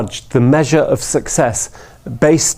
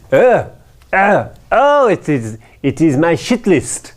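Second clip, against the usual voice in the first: put-on surprised French accent, oh pitched up; light French accent, spotting the names on the wall; 4 semitones higher than usual. Finance director recognizing the names on the projector, French-accented. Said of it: "it's France, after all".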